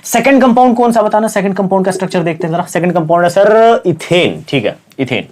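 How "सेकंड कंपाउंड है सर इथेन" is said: ordinary talk, nothing marked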